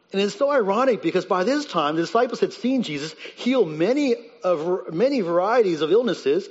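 full-force preaching. Acted. Preacher urging the congregation about the disciples' unbelief, full-force preaching. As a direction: drive the point home to the congregation.

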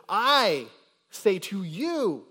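"I" punched high and loud, "you" drawn out; loud, slow emphasis on "I" and "you"; emphatic stress. Shown as a demonstration. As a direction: hammer "I", then stretch "say to you" slowly. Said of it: "notice the emphatic emphasis".

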